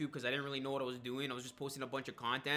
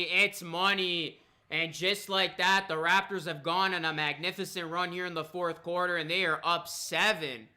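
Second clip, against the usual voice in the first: live play-by-play; 2 semitones higher, 5 dB louder than usual. Acted. Live game commentary.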